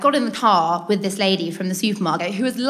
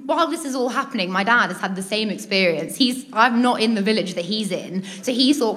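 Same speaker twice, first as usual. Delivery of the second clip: ordinary talk, nothing marked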